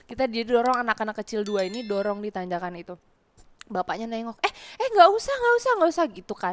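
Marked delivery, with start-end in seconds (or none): 4.4-6.0 high, loud hurried refusal; loud flustered refusal. Retold or imitated. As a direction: wave off the kids' help. Man refusing help.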